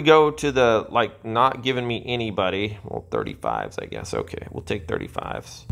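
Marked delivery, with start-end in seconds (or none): 2.8-5.7 low, soft resigned mumble; muttering. Real, not acted. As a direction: mutter it under your breath.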